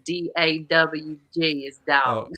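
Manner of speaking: slow spelled-out Southern drawl; Southern accent. Shown as a demonstration. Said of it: "how to speak Southern".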